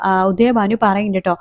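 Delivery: normal talking voice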